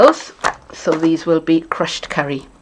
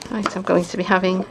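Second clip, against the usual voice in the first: ordinary talk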